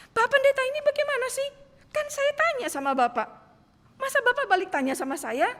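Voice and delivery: high, loud exasperated complaint; indignant protest. Retold or imitated. Woman protests to the pastor who answers with a question, exasperated. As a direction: push back at the pastor, half outraged.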